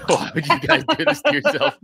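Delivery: laughing while asking. Real, not acted. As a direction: ask through real laughter. Host laughs at candidates.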